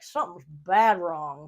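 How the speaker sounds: slow country drawl on something's bad wrong; country accent. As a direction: say it like a rural Southerner. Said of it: "just in a country voice".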